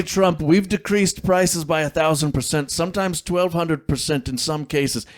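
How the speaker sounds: high-pitched mock presidential boast; Donald Trump. Co-host playing the president boasting of price cuts, mock impression. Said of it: "Yes, Mister President".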